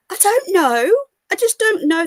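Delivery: high, loud exasperated moan. Acted. Host despairs to her live chat, loud and high. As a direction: throw up your hands in despair. Exasperated over disliked presenter.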